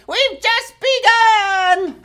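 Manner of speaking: high, slow, tiny-voiced line; little dogs' voice. Little dogs in the song announcing they've just begun, high and slow. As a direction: sing it like a little puppy. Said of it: "The little ones stop to say".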